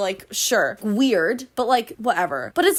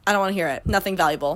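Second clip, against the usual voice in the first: usual speaking voice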